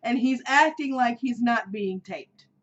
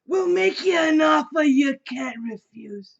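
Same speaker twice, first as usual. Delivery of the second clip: mobster impression; 4 semitones higher, 6 dB louder than usual. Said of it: "Let me see if I can".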